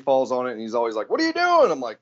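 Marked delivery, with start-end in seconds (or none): 1.1-1.7 much higher-pitched imitation of a puzzled brother; curious brother. Quoting brother asking about Maker Camp, high and curious. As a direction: pitch way up, mock puzzled question.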